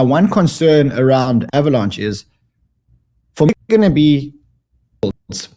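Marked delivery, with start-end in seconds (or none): none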